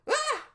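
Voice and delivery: very high, loud short scream; startled yelp. Acted. Startled girl dropping the guy, high yelp. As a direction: shriek in sudden fright.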